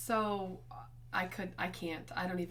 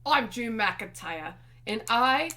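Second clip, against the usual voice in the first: loud, slow mock Scottish accent; Drew McIntyre; 2 semitones higher, 9 dB louder, slower than usual. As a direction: announce yourself in a put-on Scottish accent. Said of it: "Can I do a Scottish accent?".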